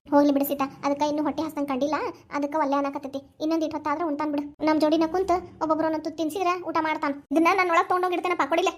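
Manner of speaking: usual speaking voice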